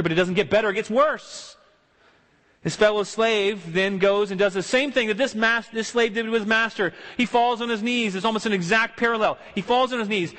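ordinary talk

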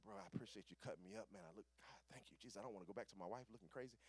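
low, soft grateful thanks; hushed, quick retold gratitude; thankful murmur. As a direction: murmur heartfelt relief. Retold relieved thanks to barber.

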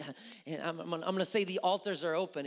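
normal talking voice

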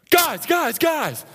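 high, loud, urgent calling out; frantic yelling. Retold or imitated. Marine on the logjam yelling down to his friends, high and frantic. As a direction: yell to the guys like something's wrong. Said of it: "he's like".

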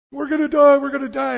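loud, high panicked cry; mock panic. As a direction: cry out like panicking disciples in a storm. Disciples panicking in the storm.

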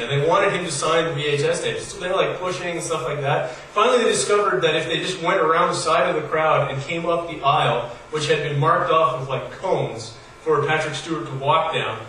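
usual speaking voice

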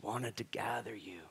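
slow, whispered lament; hushed, drawn-out regret; grieving whisper. Acted. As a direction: whisper it sorrowfully. Voicing Jesus lamenting in tears.

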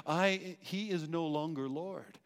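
normal talking voice

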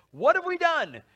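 high, loud cry of dismay; dismayed Pharaoh. Acted. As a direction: gasp it out as a shocked king. Pharaoh realizing loss of slaves.

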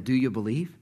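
ordinary talk

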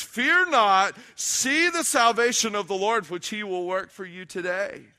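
high, loud preacher's declamation; ringing, emphatic scripture reading; preaching scripture. Acted. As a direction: proclaim the verse to the congregation, leaning on key words. Repeats the verse, preaching.

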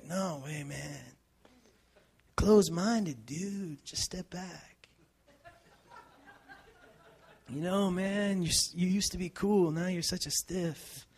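lightly voiced laid-back hippie; a hint of a dismissive stoner. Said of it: "I'm talking in hippie voice".

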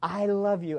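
put-on soft, low, gentle words to his kids; tender fatherly talk. Preacher to congregation, quoting what he tells his kids: soft and tender. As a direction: hush it down like a father reassuring his children. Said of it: "I'll say".

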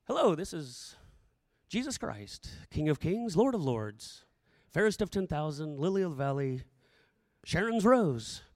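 low, stately roll of titles; mock formal introduction. Jesus pretending to introduce himself to disciples, mock formal. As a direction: announce grand titles in a deep, formal voice. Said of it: "He didn't have to say".

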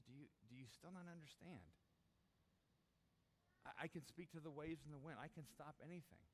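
calm them face to face; Jesus looking us in the eye, soft and reassuring; gentle assurance. Acted. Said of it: "looks at us square in the eye".

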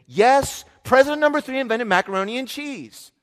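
put-on high, loud, insistent little boy; insistent child. Son insisting to mom he's right, high stubborn child voice. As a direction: play the stubborn son digging in.

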